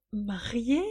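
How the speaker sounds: small rising question, dazed; devastated echo. A narrator's reading. Crushed young woman repeating the news, dazed. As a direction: repeat it as if stunned. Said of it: "répétait la jeune femme anéantie".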